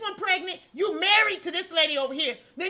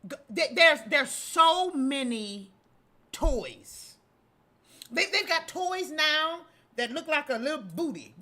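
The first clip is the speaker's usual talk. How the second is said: usual speaking voice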